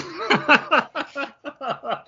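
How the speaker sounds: high, embarrassed little laugh; laughing at herself. Real, not acted. Mother laughing at herself while telling about candy cigarettes, high and light. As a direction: laugh at your own story.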